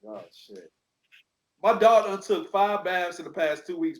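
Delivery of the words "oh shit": low, soft "oh, shit"; muttered dismay. Retold or imitated. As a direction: let it drop out low and soft.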